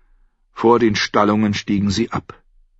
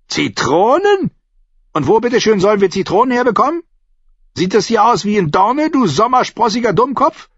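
high, incredulous, sarcastic landlady; scornful innkeeper; 4 dB louder than usual. Acted. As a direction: heap scorn on a foolish request.

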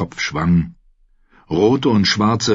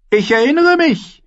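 high-pitched, carrying retort; high imperious reply; boy king's retort; 4 dB louder than usual. A narrator's reading. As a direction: brush off the warning in a high voice. Boy king retorts to warning.

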